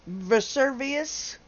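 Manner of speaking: Vesuvius repeated slowly, rising like a question; trying the correction. Real, not acted. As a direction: test out Vesuvius slowly, four syllables, questioning. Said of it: "it's pronounced Vesuvius".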